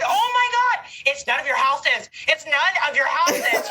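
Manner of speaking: high, laughing mock exasperation; hammed-up frazzled mom scolding; exasperated parent. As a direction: scold the kids, half laughing. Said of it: "I'm going".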